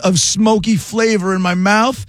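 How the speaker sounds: amazed foodie. Retold or imitated. British kid tasting ribs, amazed.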